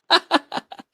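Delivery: real high giggle; high laugh. Real, not acted. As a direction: let the laugh out. Laughter after confession.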